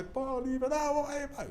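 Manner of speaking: high cartoon sailor voice; Popeye complaining. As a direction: whine it like a jilted cartoon sailor. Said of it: "e papai". (Popeye reclamando com a Olivia, voz aguda de desenho)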